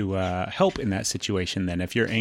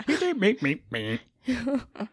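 high squeaky meep for a bleeped swear; censor beep sound; 12 semitones higher, much slower than usual. Pretend. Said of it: "We'll do a sound effect".

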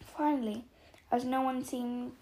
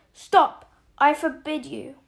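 panicked yell; 2 semitones higher, 12 dB louder than usual. A narrator's reading. Uncle tries to stop the secret coming out, panicked yell. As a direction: yell it in alarm. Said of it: "yelled Uncle Vernon in panic".

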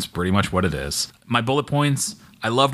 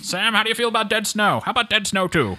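high bright announcer voice, pitched way up; game show host; 11 semitones higher than usual. Pretend.

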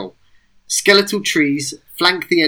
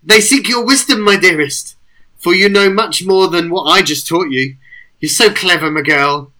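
high, loud, fond coaxing; doting praise; 3 semitones higher, 4 dB louder than usual. Acted. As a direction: praise her like a beloved pet. Sir Lucia praising Fern.

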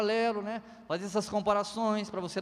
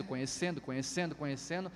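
each conhecendo softer and lower, trailing away; fading repetition; 5 semitones lower, 8 dB quieter than usual. Acted. Preacher trailing off about growing in knowledge, fading. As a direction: let conhecendo fade out step by step. Repeating word to show slow growth.

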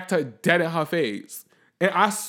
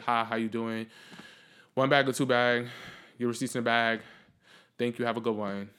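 low, soft rote customer-service lines; autopilot cashier; 7 semitones lower, 6 dB quieter than usual. Retold or imitated. Cashier to customers at the register, on autopilot. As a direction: recite it on autopilot. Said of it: "feeling very much in autopilot mode".

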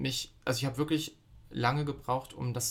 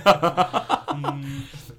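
high, loud burst of laughter; real belly laugh, pitched high; 8 semitones higher, 11 dB louder than usual. Real, not acted. Laughs at the anecdote.

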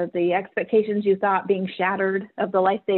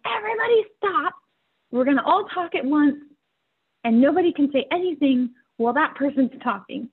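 put-on high, firm call from a twelve-year-old boy, easing down after the first words; son calling everyone to order; 6 semitones higher than usual. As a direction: halt the shouting family like a boy taking charge. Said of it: "him finally saying".

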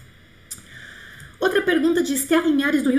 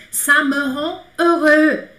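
slow, louder "Ça me rend heureux"; slow bright model; 3 semitones higher, 5 dB louder, much slower than usual. Shown as a demonstration. Teacher modelling a happy French sentence slowly. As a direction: say it slowly and happily. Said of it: "model sentence".

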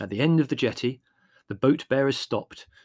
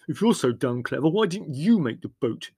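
loud and resentful; angry retort; 4 semitones higher, 5 dB louder than usual. A narrator's reading. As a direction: fire back angrily. Brothers quarrelling.

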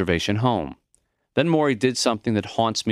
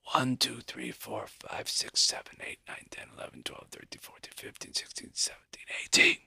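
breathy count fading into a whisper; soft, wobbly count; 2 semitones higher, 11 dB quieter than usual. A narrator's reading. Dying professor shows how little breath he has, soft wobbly whispered count. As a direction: gasp in, then whisper the numbers on failing breath, forcing out the last. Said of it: "in a soft, wobbly voice".

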